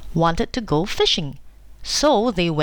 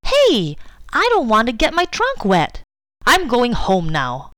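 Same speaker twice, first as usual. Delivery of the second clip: upset; 5 semitones higher than usual. A narrator's reading. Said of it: "Susie got very upset".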